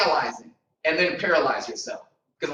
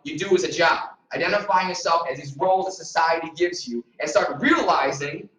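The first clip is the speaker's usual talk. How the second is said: usual speaking voice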